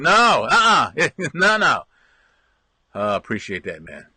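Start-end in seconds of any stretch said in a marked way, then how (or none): 0.0-1.8 mock firm refusal. Refusing the dawdler.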